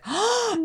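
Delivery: big high-pitched gasp of delight; excited gasp. Retold or imitated. Storyteller re-enacts her thrilled reaction to the trip, excited gasp.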